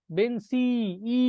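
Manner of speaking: "বেঞ্চি" with the last i held, then "ই" alone; final vowel isolated. Shown as a demonstration. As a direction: stretch the ending of "বেঞ্চি" into a separate "ই". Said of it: "শেষে, শেষে".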